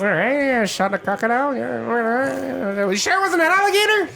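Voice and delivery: lightly high gnome voice, jotting song lines; Gilly the gnome. Acted. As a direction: gnome collects heroic deeds for a song.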